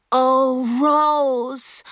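high, drawn-out "Oh, Rose"; ghastly grin. A narrator's reading. Flustered girl repeats herself to the injured boy, ghastly grin. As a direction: recite it stretched and high through a ghastly grin. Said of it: "mit grausigem Grinsen".

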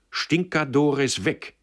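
high, loud, drawn-out order; imperious woman's command. A narrator's reading. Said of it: "befahl sie Stickler".

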